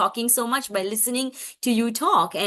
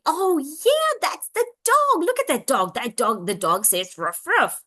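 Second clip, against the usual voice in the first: enthusiastic parent self-talk; 5 semitones higher than usual. Acted. Parent responding to child pointing at dog, enthusiastic. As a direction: marvel aloud for a small child, starting high. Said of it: "And now we can say".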